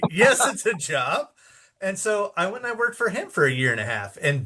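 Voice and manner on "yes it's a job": slow, higher-pitched retold reassurance; emphatic confirmation. Marketing chief answering the doubtful storyteller, drawn-out confirmation.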